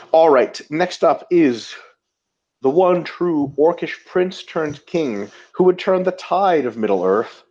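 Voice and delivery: slow, grand trailer-style build-up; drawn-out movie trailer narration; epic announcer intro. Acted. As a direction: announce him like a fantasy epic. Dramatic announcer intro of next question.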